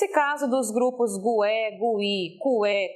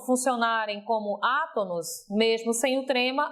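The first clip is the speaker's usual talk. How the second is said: ordinary delivery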